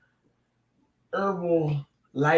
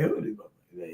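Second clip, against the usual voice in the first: low, quieter question to a friend beside him; hushed aside; 11 semitones lower, 10 dB quieter than usual. Retold or imitated. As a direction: ask under your breath so nobody hears. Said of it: "I said".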